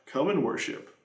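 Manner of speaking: a hushed answer to the refrain; soft echo. Acted. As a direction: let the echo fall away quietly. Echoed refrain.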